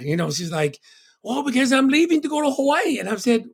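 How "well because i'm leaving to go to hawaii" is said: imitating mom. Mom quoted explaining departure.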